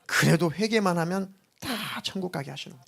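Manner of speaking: ordinary delivery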